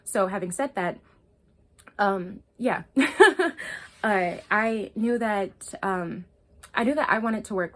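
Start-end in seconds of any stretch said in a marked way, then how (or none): 2.9-4.0 bright burst of laughter; nervous giggle before the story. Real, not acted. Laughing to her viewers.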